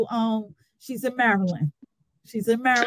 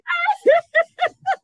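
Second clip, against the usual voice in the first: bright high laugh; 5 dB louder than usual. Real, not acted. Guest laughs high at the red velvet confession.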